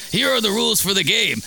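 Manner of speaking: goat character voice. Retold or imitated. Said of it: "I was reading it as the goat".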